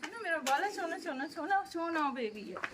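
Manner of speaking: soft high cooing. Real, not acted. Cooing to baby.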